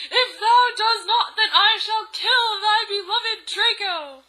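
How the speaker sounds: high, loud, hammed-up threat; Voldemort yelling. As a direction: thunder it like a mock-Shakespearean villain. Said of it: "he yelled".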